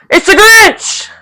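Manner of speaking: high-pitched drawn-out yell. Real, not acted. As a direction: yell it out high, loud and stretched. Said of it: "I'm sorry, I yelled again".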